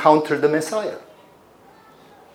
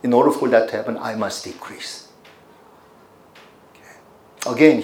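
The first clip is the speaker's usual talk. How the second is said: ordinary delivery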